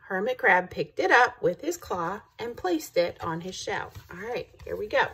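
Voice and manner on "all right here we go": ordinary delivery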